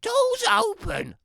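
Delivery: a wolf's put-on grandma voice; wolf faking granny. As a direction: pretend to be granny in bed. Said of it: "I said".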